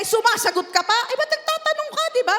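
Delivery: mock outraged parent; scolding parent. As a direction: snap at the child for talking back. Parent voiced scolding child.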